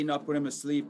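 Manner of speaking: ordinary delivery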